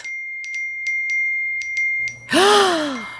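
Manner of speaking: long, high, shocked gasp; dramatic gasp. Acted. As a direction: gasp as if seeing something shocking. Cold open skit, shocked reaction.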